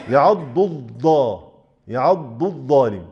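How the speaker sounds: يعض الظالم with the ظ turned into ض; slow demo of the rarer error; both made dad. Shown as a demonstration. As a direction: say الظالم as الضالم, both letters ض. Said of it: "يجعل الاتنين شبيهة بالضاد".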